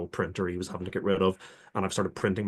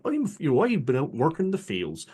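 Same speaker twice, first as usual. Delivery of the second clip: higher-pitched West Country farmhand; West Country accent; 4 semitones higher than usual. Shown as a demonstration. Player demos his game accent, earnest West Country attempt. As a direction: do a rustic West Country worker from the fields. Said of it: "trying my best to make a genuine".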